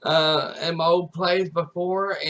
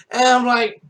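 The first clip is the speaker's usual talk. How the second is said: ordinary delivery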